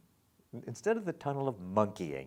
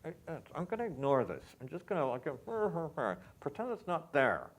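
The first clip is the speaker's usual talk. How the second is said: mock singer; 5 semitones higher than usual. Shown as a demonstration. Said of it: "if you just scan it to say".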